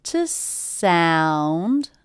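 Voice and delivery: "To sound" with "sound" stretched and stressed; light "To", drawn-out stressed "sound". Shown as a demonstration. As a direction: tuck "To" away and lean long on "sound". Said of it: "that has stress too".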